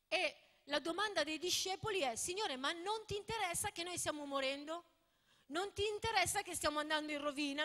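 fervent sermon delivery, pleading; preaching. Acted. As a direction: preach it to the congregation with force. Preaching with force.

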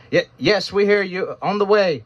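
high-pitched voice calling down a hole; a rescuer answers from far above. A narrator's reading.

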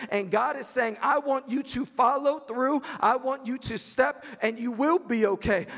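fervent preacher's cry; preaching. Acted. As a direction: proclaim it over the church. Preaching at full force.

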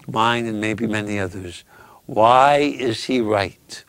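ordinary delivery

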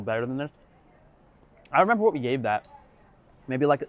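talking with food in mouth; eating while speaking; chewing. Real, not acted. Seen 2: Holds burger; lips closed, cheeks working between phrases after earlier bites.

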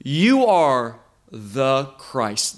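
"You" drawn out and emphasized, then slow and weighty; stress on You. Retold or imitated. Peter answering who Yeshua is, emphatic stress on "You". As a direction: lean hard and long on "You". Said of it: "there's an emphasis on this word".

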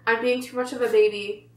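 peeling mask off mouth; speaking with lips pulled; lips stretched by face mask. Real, not acted.

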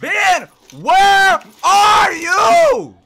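long, drawn-out high shout; calling out. Real, not acted. Player yelling for Ben, loud drawn-out call. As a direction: holler it slowly, word by word, very loud.

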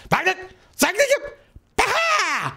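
very high-pitched goblin squawking; goblin hexer. Acted. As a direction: screech it out like a little goblin casting a hex. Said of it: "The goblin hexer kinda goes like".

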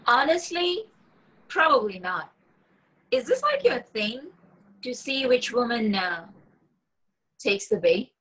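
high, challenging woman's voice; young woman's voice. Acted. Woman confronting her catfishing date, high and pointed. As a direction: call him out as the young woman, pitched well up.

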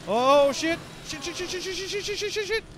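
rising frantic string of curses; panicked swearing. Real, not acted. Chased in horror game.

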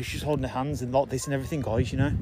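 ordinary talk, nothing marked

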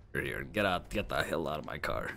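low, quiet, weary order; fed-up friend. Acted. Friend ordering drunk girl out of car, low and fed up. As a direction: send them away without raising the voice.

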